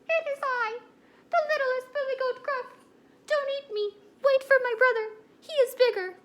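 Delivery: tiny, very high squeaky goat voice; high-pitched little goat, piping up loudly; littlest billy goat. Acted. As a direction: plead with the troll in a high, loud little squeak. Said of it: "Sam is the goat".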